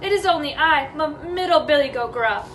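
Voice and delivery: timid stammer on the name; mildly frightened middle goat; shaking, scared goat. Acted. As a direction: answer nervously, tripping on your own name. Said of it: "shook so hard he almost fell down".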